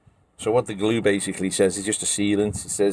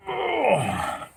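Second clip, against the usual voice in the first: strained groan. Real, not acted. Lid still stuck.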